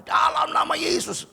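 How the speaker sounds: loud, high, slow command; preacher laying hands on the herpes, loud and slow; commanding prayer. Retold or imitated. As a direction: declare it with authority. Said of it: "Saya tumpangin tangan".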